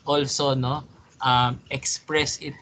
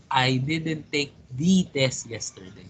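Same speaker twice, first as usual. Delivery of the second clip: "the" stressed and said as "thee". Shown as a demonstration. Answering Mark's question about the test, stress on "the". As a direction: push "the" out as an emphatic "thee". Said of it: "Like the word the".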